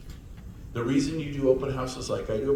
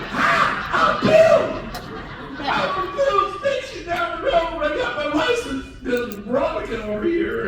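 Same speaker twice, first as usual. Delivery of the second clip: mocking Bill; 10 dB louder than usual. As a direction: play the dopey husband way up high.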